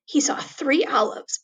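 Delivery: usual speaking voice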